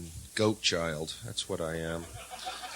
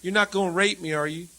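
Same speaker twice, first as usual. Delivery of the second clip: mock fearful landlady; 8 semitones higher, 5 dB louder than usual. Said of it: "She goes".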